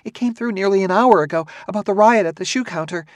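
high, rushed voice of a worried wife; hurried. A narrator's reading. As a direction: rush it out high-pitched, piling phrase on phrase. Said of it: "She said in a hurried whisper".

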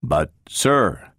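hesitant, drawn-out objection; slow, shocked. A narrator's reading. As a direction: object slowly, with a pause after "But". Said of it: "Then, slowly".

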